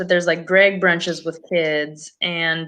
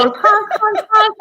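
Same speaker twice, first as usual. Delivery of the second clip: much higher honks, goose imitation; goose honking voice; 13 semitones higher, 3 dB louder than usual. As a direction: honk like a silly goose. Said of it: "The goose goes".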